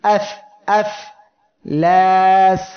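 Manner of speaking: এফ, এফ, ল্যাস said crisply, the final ল্যাস loud; quick easy recitation. Shown as a demonstration. Tajweed teacher demonstrating a quick, easy letter. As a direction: tap out এফ, এফ lightly then land ল্যাস loudly. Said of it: "একটা উদাহরণ পড়ি".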